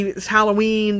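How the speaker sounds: ordinary talk, nothing marked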